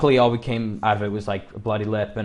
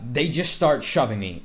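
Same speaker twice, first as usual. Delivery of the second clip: normal talking voice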